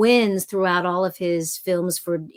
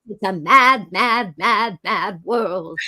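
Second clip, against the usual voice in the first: "mad, mad, mad, mad" each set apart; emphatic title with every "mad" counted; four separate mads; slower than usual. Shown as a demonstration. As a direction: punch the first "mad", then beat out the other three. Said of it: "There's four mads".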